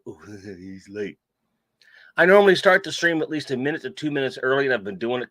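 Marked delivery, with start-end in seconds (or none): 0.0-1.2 slow, low, hushed mocking echo; low mock murmur with a chuckle; mimicked viewer cascade. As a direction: drag it out softly, imitating the complainers. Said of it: "started a cascade of".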